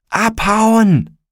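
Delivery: high, loud yell to flee; urgent shout. A narrator's reading. Felix to friends fleeing the burning embankment, loud high yell. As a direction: yell it high to get everyone running. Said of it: "rief Felix".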